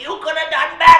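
very high, loud squeaky put-on voice; mock critic voice. Mocking a critic's voice.

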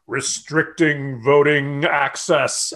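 drawn-out, skeptical quoting; slow, pointed scare quotes; mocking quotation. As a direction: stretch each word of the overblown phrase. Mockingly quotes the network's phrase.

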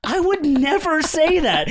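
very high, loud, drawn-out protest; emphatic mock denial. Friend denying the compliment, shrill mock outrage. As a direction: imitate an appalled friend, stretching it out.

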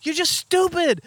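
exasperated postal worker. Retold or imitated. Post office guy telling them they're stupid, high mock scold.

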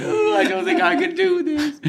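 very high, feeble self-pitying whine; hammed-up tearful self-doubt in a tiny voice; mock whimpering. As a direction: whimper it like a hopeless sad sack. Acting out Sad Phil's whining.

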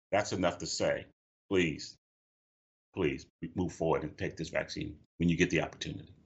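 low, heavy plea with pauses round "please"; real grief. Real, not acted. Bereaved brother urging listeners to take the vaccine, grieving. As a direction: plead quietly, holding back real emotion. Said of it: "because the emotion is, and the loss is, is coming through the screen".

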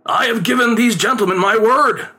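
high, earnest theatrical plea; storybook father's high-pitched entreaty; pleading. A narrator's reading. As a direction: plead with your son, voice lifted. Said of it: "his father pleaded".

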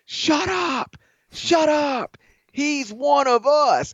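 high-pitched mock shout, drawn out at the end; imitated yelling. Coach scolding the Cameron Crazies, mock yelling. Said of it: "and was yelling".

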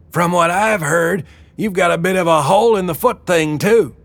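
high-pitched boss woman firing back; quick retort. Acted. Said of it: "Wilma shot back".

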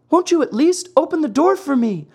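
very high, quick puppet plea; beseeching cry. Acted. Pinocchio begs the maiden at the window, beseeching. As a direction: beg urgently in a high little voice. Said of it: "cried Pinocchio in a beseeching voice".

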